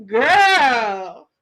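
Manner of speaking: ordinary delivery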